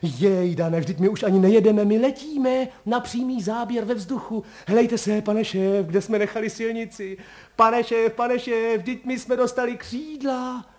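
high, loud amazed chatter; a driver thrilled, voice up high; excited driver. Acted. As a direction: marvel breathlessly at flying. Driver marvels car is flying.

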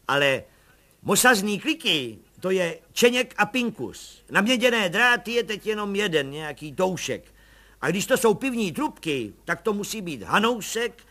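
high, eager little agent; proud little agent explaining the case. A narrator's reading. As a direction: pipe it up like a keen small-time cop. Pištora's voice in the story.

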